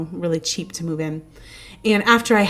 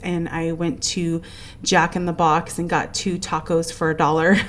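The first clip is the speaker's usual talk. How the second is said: normal talking voice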